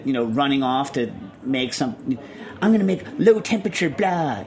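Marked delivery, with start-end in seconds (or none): 2.6-4.4 mock announcement. Mocks an unrousing term.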